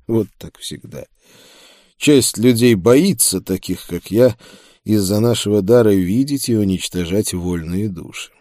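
plain reading voice

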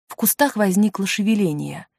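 ordinary delivery

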